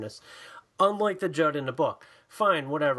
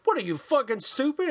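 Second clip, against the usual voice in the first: high, indignant playful insult; higher-pitched acted irritation; mock quarrel; 8 semitones higher than usual. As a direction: pick a pretend fight. Acted quarrel between the two hosts.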